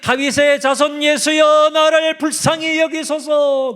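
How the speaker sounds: high, loud, slow plea; loud desperate beggar's cry; blind beggar crying out. Retold or imitated. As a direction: beg for mercy at the top of the voice. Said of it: "소경 거지 바디메오가".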